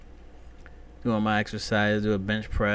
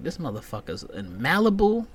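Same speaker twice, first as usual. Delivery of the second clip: ordinary delivery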